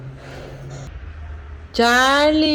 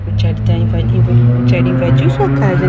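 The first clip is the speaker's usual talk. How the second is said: much lower, louder playful voice; mock deep voice; 12 semitones lower, 6 dB louder, faster than usual. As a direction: tease the kitten in a put-on low voice. Teasing commentary to kitten. (voz grossa de brincadeira, mais alta)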